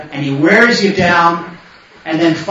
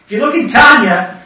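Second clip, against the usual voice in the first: ordinary talk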